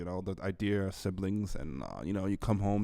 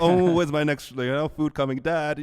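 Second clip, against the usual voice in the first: put-on higher, louder whiny kid asking dad for food; entitled teen; 6 semitones higher, 7 dB louder than usual. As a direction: play the spoiled teenager begging dad.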